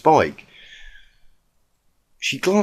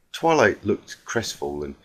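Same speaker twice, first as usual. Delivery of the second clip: plain reading voice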